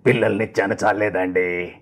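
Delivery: low plaintive film voice, dragged endings; lightly change the pitch, stretching the last words; Kamal Haasan impression. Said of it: "చెప్పినప్పుడు మార్చారు, ఆ pitch మార్చి".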